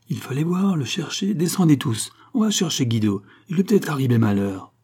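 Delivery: rally the workers, urgently; urgent call. Acted. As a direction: inspector urging the whole crew down to search. Urging workers to search.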